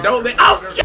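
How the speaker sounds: startled loud yelp; scared. Real, not acted. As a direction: cry out, jolted by a sudden fright. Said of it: "That scared me".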